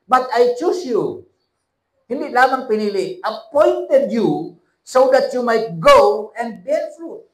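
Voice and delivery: loud, high preaching with slowed scripture; fiery preaching. Acted. Pastor preaching loudly to his congregation. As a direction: proclaim it to the whole church.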